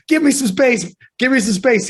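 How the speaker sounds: high, urgent nervous plea; anxious pleading. Retold or imitated. Dad in passenger seat, anxious high pleading. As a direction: beg the driver to move over.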